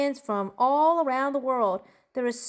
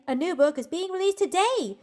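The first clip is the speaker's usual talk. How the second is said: higher-pitched, thrilled exclamation; excited; 5 semitones higher than usual. Acted. Fan announcing a new book released today, excited. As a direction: burst out with the big news, lifting on today. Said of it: "I am so excited".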